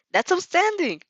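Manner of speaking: high, loud praise; amazed exclamation. Acted. Class rep praising Athena's new wings, high and loud. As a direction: burst out in admiration.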